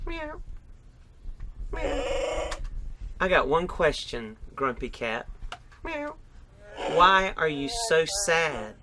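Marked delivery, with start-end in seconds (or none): 0.1-2.5 high, squeaky play-cat meows; dad playing Grumpy Cat with high mewing. Dad voices Grumpy Cat mask. 5.8-6.2 high, squeaky play-cat meows; dad playing Grumpy Cat with high mewing. Dad voices Grumpy Cat mask.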